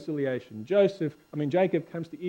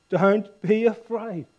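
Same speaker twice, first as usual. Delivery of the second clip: higher, slow, drawn-out scripture reading; emphatic reassurance; 5 semitones higher, 4 dB louder, slower than usual. Acted. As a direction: reassure them firmly, stretching the words. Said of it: "And he reassured them".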